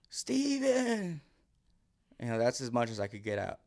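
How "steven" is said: thin, high strained call; reaching for a scream with no strength; weak scream. Retold or imitated. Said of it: "I screamed his name again".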